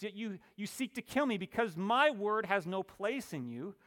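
high, ringing scripture proclamation; emphatic preaching. Acted. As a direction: proclaim it forcefully to a congregation. Preaching and reading scripture aloud.

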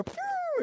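high imitated whoosh; springing-up whoosh. Preacher imitates roots springing up, high whoosh. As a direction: make a high "phew" of something shooting up.

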